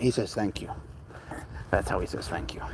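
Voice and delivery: soft breathy whisper to the viewers. Real, not acted. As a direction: hush it like a secret. Explaining cat's quiet thank you.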